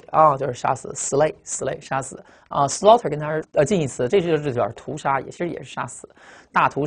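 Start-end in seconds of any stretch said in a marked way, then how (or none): none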